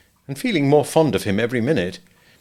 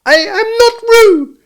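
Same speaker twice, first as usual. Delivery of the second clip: very high, slow, loud squeak; loud protest; 11 dB louder, slower than usual. A narrator's reading. Piglet mistaken for Roo by Kanga, loud protest. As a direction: squeal each word out emphatically. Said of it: "said Piglet loudly".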